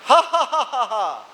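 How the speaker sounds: a hearty laugh to get the class going; demo laugh again. Shown as a demonstration. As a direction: laugh loud to lead the room. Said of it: "Just try".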